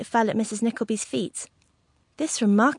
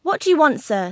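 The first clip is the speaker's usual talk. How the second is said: mildly affronted, high then dropping; a touch of coy indignation; simpering displeasure; 5 semitones higher, 5 dB louder than usual. A narrator's reading. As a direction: challenge an intruder with prim displeasure. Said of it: "with a sort of simpering displeasure".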